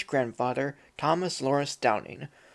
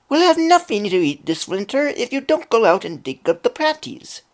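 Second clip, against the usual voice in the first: higher, louder nagging Irish wife; Irish accent, scolding; 8 semitones higher, 5 dB louder than usual. Acted. Wife nagging lazy Jamie in an Irish accent. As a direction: nag him in a strong Irish brogue. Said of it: "best attempt at an Irish accent whenever the characters speak".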